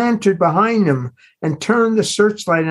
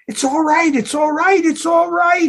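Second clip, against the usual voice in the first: building shout; 10 semitones higher than usual. A narrator's reading. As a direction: start reassuring and end in a shout. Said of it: "he shouted".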